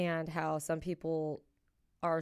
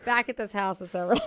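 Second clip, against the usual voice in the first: words breaking up with laughter, higher and louder; laughing; 6 semitones higher, 9 dB louder than usual. Real, not acted. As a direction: laugh through the embarrassing memory. Retelling embarrassing snowy mountain mishap.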